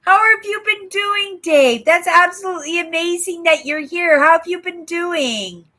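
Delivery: high-pitched, eager welcome; excited greeting. Real, not acted. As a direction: greet a long-missed friend with real excitement. Greeting a long-absent viewer.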